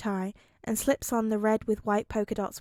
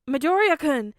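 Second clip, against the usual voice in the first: loud, higher call across the room; yelling; 5 semitones higher, 8 dB louder than usual. A narrator's reading. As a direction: shout his name at the top of your lungs. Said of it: "Mirio yells at the top of his lungs".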